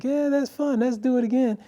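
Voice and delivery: put-on high-pitched playful little kid voice; giggling toddler. Little boy to his rescuer, giggling with glee. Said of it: "the kid's giggling like".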